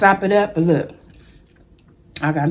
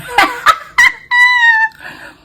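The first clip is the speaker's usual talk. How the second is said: high, loud burst of laughter at her own joke; shrieking laugh; 5 dB louder than usual. Real, not acted. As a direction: squeal with laughter. Joke about God sending a king.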